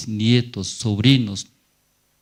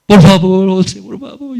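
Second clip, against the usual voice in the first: pleading crying; 9 semitones higher, 11 dB louder than usual. Retold or imitated.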